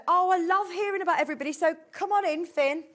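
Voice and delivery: imitating Sylvie's eager welcome; cheerful. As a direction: welcome him in brightly. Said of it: "she said".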